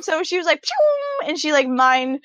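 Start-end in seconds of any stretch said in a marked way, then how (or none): 0.6-1.3 high "pew" sound effect; imitated zap noise. Showing a mind message being sent, playful zap noise. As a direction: shoot out a high little zap.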